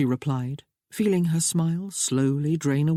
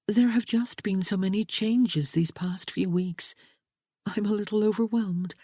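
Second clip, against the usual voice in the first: a higher, lightly voiced young woman, earnest and pleading; young bride Sophia; 6 semitones higher than usual. A narrator's reading. Bride reassuring her new husband, raised lighter voice. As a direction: play the bride gently begging James to understand.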